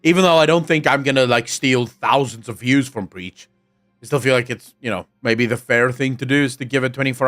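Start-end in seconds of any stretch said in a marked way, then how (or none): none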